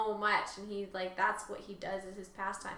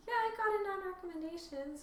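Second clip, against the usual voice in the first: high, airy mock girl's voice, much higher; imitating her; 10 semitones higher than usual. Retelling a character's reply in a mock high voice. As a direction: toss it off lightly as her. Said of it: "where she was like".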